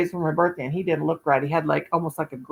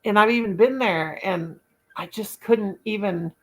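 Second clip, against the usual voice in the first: normal talking voice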